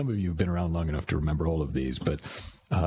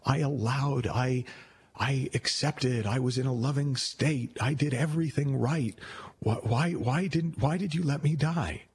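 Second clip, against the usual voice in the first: higher-pitched, mildly indignant protest; a bit upset; 7 semitones higher, slower than usual. Acted. Drowned man complaining to God, mildly pissed off. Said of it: "he's a little bit pissed off".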